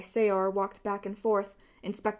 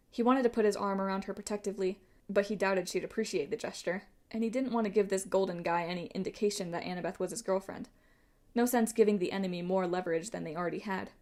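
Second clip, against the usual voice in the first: ordinary delivery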